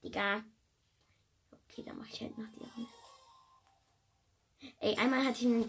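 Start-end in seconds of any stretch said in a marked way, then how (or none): 1.5-3.0 soft unvoiced whisper; resigned whisper. Real, not acted. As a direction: sigh it under your breath.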